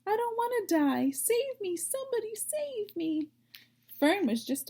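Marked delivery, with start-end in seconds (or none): 0.1-3.3 much higher, frantic pleading; panicked plea. Acted. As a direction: beg for your life like a terrified piglet. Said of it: "screamed Wilbur".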